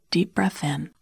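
ordinary delivery

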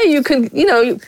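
usual speaking voice

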